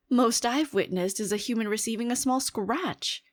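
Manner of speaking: ordinary delivery, nothing marked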